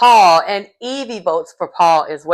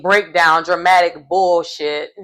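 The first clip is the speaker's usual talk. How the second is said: ordinary talk, nothing marked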